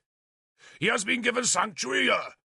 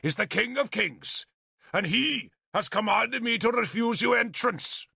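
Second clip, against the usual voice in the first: higher, lofty proud refusal; 9 semitones higher than usual. A narrator's reading. Bishop proudly refusing Arthur entry, higher voice. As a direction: announce your holy command. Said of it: "Caddoc said proudly".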